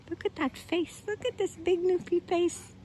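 high-pitched pet-adoring voice. Real, not acted. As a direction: coo over the dog in a high voice. Doting on her dog's face.

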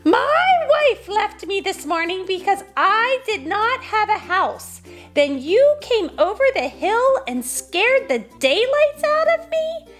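high-pitched indignant farmer; exasperated, high-voiced tirade; bellowing rant. A narrator's reading. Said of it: "bellowed Peter Peter".

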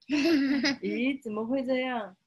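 giggling dismay; half-laughing, playfully puzzled kitten; laughing surprise. Acted.

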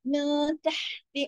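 slow recital, holding a hidden nasal n in मिन; light nasal ikhfa. Shown as a demonstration. Teacher demonstrates ikhfa to pupils, slow nasal hold on मिन. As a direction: hum the n of मिन lightly before तहति. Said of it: "बारीक इख्फा हो रहा है".

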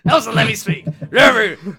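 loud, high mock shout, rough-edged; rude interruption. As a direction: burst in as a hammed-up heckler. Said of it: "rudely interrupt me anytime".

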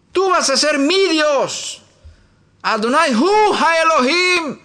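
high, slow, proclaiming prayer; solemn proclamation. Acted. Preacher crowns the prayer, slow solemn proclamation. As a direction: declare it slowly to God.

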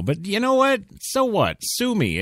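high, defiant and cheeky. Real, not acted. Host defends his smoking to co-host, high and defiant. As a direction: toss it off with a shrug in the voice. Defiant retort, much higher.

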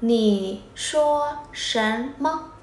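"你说什么" đọc rất chậm, tách từng chữ; nói "你说什么" chậm rãi làm mẫu; tiếng Trung chậm. Shown as a demonstration. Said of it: "Cái gì?". (đọc chậm "你说什么")

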